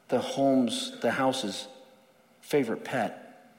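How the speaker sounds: usual speaking voice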